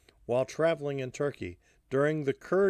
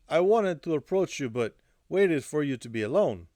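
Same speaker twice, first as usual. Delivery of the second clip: put-on higher-pitched Russian-accented woman's voice; thick Russian accent; 5 semitones higher, 5 dB louder than usual. A narrator's reading. Alla tells Hardy why she waited, Russian accent. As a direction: explain yourself with a Russian accent. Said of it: "in her thick Russian accent".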